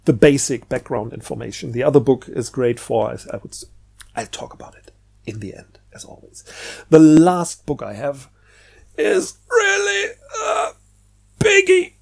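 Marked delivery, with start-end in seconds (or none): 3.1-6.4 softer, unvoiced whisper aside; hushed side note to viewers. Real, not acted. Aside about a book, said for later. 9.0-11.9 much higher, squealing emphasis on biggie; mock-awed squeal; excited exclamation. Real, not acted. Presenting a huge book.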